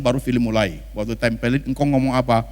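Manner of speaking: ordinary talk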